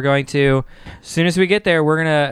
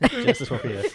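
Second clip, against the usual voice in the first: laughing; 5 dB quieter, faster than usual. Real, not acted. Laughing echo of the chief's correction.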